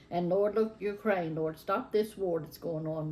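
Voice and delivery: usual speaking voice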